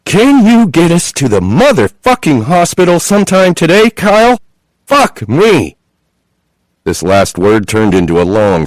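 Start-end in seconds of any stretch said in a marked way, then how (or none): 0.1-5.8 high, loud screaming outburst; tearing yell. A narrator's reading. Chloe in labour yelling at the driver, screaming. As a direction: tear it out of the throat in fury. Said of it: "the words come tearing out of her throat".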